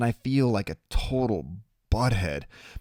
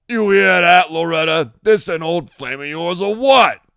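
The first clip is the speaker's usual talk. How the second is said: loud, raised, jeering voice; mocking tattooed biker; 9 semitones higher, 10 dB louder than usual. A narrator's reading. Tattoo dude laughing at Loretta's visitors, loud and mocking. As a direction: biker hollering a taunt.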